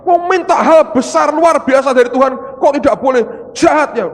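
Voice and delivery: loud, high offended protest; imitated angry protest. Offended minister protesting to the preacher, mock angry. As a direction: complain sharply that he is wicked.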